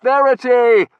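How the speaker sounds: loud, high searching call; shouting a name. A narrator's reading. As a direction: shout to find her. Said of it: "shouting her name".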